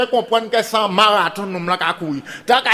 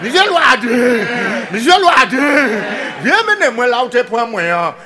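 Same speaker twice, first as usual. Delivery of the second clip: crying pig; 4 semitones higher, 5 dB louder than usual. Acted. Captive pig to sleeping Loaden at night, wailing loudly. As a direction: wail it out long like a captive pig. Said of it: "cochon qui a crié".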